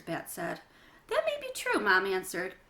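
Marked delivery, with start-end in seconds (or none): 1.1-1.8 high gentle reply. Acted. Mom answering Bat.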